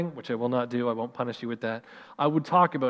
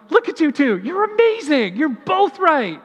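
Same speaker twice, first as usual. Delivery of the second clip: much higher and louder, gushing; delighted praise; 12 semitones higher, 7 dB louder than usual. Retold or imitated. Pastor praising his two children in the car, high and delighted. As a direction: burst out proud of the kids. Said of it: "I was like".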